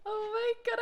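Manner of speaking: usual speaking voice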